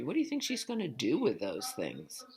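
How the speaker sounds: usual speaking voice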